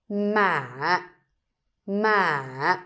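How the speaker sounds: ma dipping and pulled down; hỏi tone on ma, dipping; Vietnamese question tone. Shown as a demonstration. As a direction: pull ma down then back. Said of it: "going up a little bit ... pull the word down".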